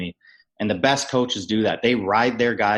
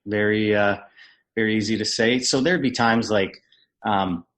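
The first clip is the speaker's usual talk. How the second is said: usual speaking voice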